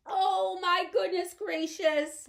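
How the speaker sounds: high, loud, slow tearful moan; Homily moaning in despair on the chest of drawers. Acted. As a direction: wail it out in dismay. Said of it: "moaned Homily again".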